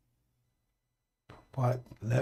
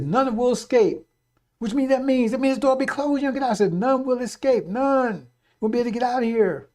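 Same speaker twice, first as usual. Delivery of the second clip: higher, forceful sermon delivery; fervent preaching; 5 semitones higher, 4 dB louder than usual. Real, not acted. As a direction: preach it urgently, voice raised in pitch. Preaches at raised pitch and force.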